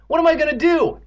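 very high, loud wail; panicked wail. Acted. Evan paces and despairs, wailing. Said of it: "Evan wailed".

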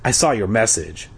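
ordinary delivery

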